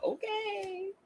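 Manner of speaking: high, soft, drawn-out doubt; dubious. Retold or imitated. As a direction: let it float up sceptically. Retold sceptical reaction to survey.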